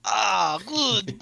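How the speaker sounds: put-on high Russian-accented approval; pleased grunt in a Russian accent. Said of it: "you do not have to do the Russian accent".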